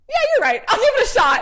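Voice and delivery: high, loud, suddenly upbeat; eager high reply. Retold or imitated. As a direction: sound newly convinced and keen. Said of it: "And then Cole's like".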